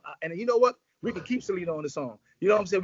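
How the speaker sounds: ordinary talk, nothing marked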